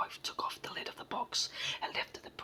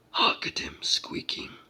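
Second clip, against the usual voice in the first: loud, slow giant's cry; delighted giant; 10 dB louder, slower than usual. A narrator's reading. Giant amused by the pleading brownie, crying in delight. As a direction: boom it out with glee. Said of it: "cried the giant in delight".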